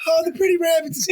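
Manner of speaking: high, lightly voiced simple giant; a hint of a childlike big man; Lenny impression. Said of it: "Like George and Lenny".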